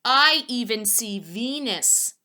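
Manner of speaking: excited and slow; proud exclaim. Acted. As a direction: savor the discovery. Hudson proud to find Venus.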